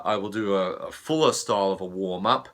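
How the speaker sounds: ordinary talk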